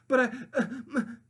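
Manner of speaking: high, loud stammering pig voice; high startled pig sputter; flustered pig. Acted. Pinkerton stammering.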